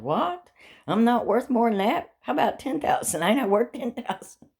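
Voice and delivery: squeaky offended disbelief; mock indignant surprise. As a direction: act insulted to be worth so little. Retold reaction to low bounty.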